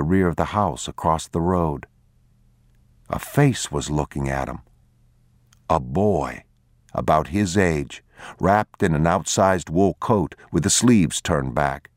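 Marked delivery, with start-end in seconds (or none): none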